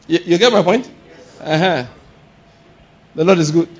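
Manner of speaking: ordinary talk, nothing marked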